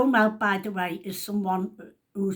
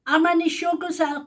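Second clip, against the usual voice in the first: raised call to the next room; shouting through; 8 semitones higher, 6 dB louder than usual. Retold or imitated. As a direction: call it out loud through the wall. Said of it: "I shouts through to her".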